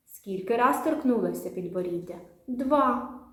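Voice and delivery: ordinary delivery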